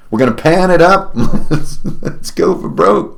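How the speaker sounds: cracking up mid-line; high, giggly delivery; laughing. Real, not acted. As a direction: say it through rising laughter. Laughs through own prospector lyrics.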